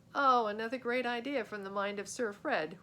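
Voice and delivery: ordinary delivery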